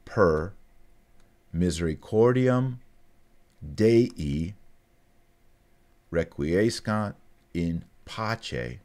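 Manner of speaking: slow, spaced recitation. Shown as a demonstration. Teacher recites slowly for students to repeat. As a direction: say each word slowly so they can follow. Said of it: "I'll say it again very slow".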